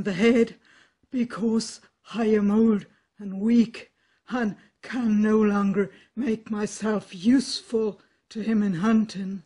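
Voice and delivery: put-on loud, lamenting old dog; a worn-out hound pleading his case. Dog character speaks.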